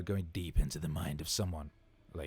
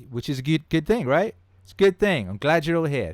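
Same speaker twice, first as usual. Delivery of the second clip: excited; 7 semitones higher, 11 dB louder than usual. Real, not acted. Reacting to fast chat.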